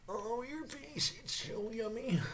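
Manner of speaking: high, slow, soft gushing coo. Acted. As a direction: coo at your face like it's dessert. Gushing over the smell.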